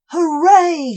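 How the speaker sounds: loud high hooray; cheering shout. Acted. Cobwobblies cheering after toppling the snail. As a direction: cheer with victory. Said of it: "shouted the cobwobblies".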